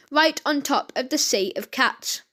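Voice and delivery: usual speaking voice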